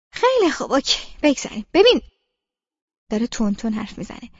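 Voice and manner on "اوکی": ordinary delivery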